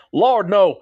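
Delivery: high, loud Texan drawl on LORD, NO; Texan accent. Shown as a demonstration. Host redoing a listener's comment in a hammed-up Texan accent. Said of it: "can I say it in a text and accent".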